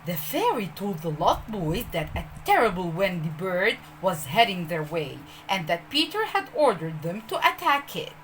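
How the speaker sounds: ordinary delivery, nothing marked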